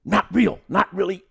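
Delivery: high, loud scolding imitation; imitated pissed-off correction. Stunt director corrects the actor, imitated annoyed scolding. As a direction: scold sharply, as the annoyed coordinator. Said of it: "he's kinda pissed".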